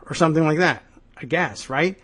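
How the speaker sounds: ordinary talk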